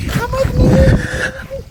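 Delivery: drawn-out high squeal; excited high call. Real, not acted. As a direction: stretch it out high and thrilled. Calling cameraman, rising excitement.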